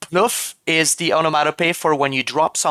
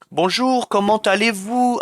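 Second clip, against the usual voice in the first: higher-pitched Parisian-style French; French-from-France accent; 6 semitones higher than usual. Shown as a demonstration. Streamer demonstrates France's accent to chat, French accent. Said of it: "I can't fully do the French accent".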